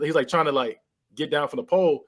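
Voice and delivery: normal talking voice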